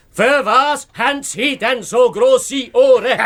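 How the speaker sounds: "Ohren" called out loud so the deaf grandmother hears. Acted. Detective repeats the question to grandmother over the noise, loud. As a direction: raise the voice, spell it out for her. Es ist so laut da drinnen.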